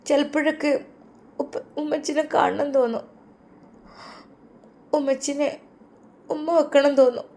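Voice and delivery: high, soft and halting, near tears; tearful little girl. Acted. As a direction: daughter telling father she misses mum. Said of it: "ഉപ്പാൻ്റെ കുട്ടി കരയില്ല ട്ടോ". (ഉമ്മയെ ഓർത്ത് കരയുന്ന മകൾ)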